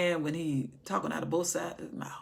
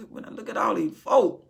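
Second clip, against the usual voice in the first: ordinary talk, nothing marked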